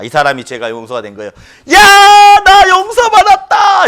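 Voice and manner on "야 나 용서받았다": high, loud cry of relief; joyful shout. Retold or imitated. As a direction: shout it like a forgiven man. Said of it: "이렇게 하죠". (용서받은 기쁨의 외침)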